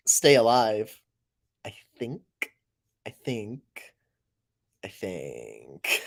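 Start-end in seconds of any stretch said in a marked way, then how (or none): 1.6-3.6 soft trailing "I think"; quiet trailing. Shown as a demonstration. 4.8-6.0 very low put-on "I think"; deep goofy voice. Shown as a demonstration.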